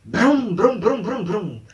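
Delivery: brum-brum of a revving motor; imitated engine revving. Describing something speeding up behind him, imitated revving. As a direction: rev a little engine with your mouth. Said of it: "um trem acelerando".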